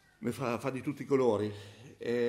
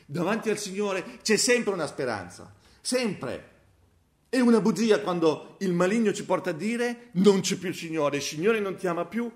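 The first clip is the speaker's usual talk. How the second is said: high, loud proclaiming sermon; fervent preaching; 8 semitones higher, 9 dB louder than usual. Acted. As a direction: cry out hope to the church. Preaching at full force. (predicazione gridata, alta e forte)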